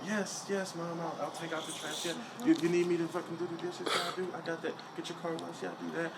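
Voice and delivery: soft, obliging voice for talking to Mom. Acted. Dutiful son promising Mom he'll do chores, soft mom voice. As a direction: softly agree to every chore. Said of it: "uh, my mom voice".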